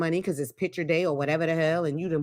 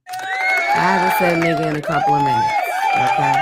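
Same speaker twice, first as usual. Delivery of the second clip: shrieked high and loud; a big comic shout; mock shout; 5 dB louder than usual. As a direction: yell it out for applause. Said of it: "I needed a hand clap on that".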